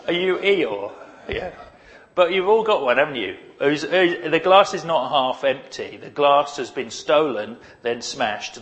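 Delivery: normal talking voice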